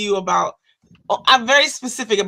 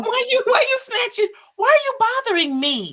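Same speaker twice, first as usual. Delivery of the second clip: whiny protest; 12 semitones higher than usual. Acted. Mock whiny protest at Fred.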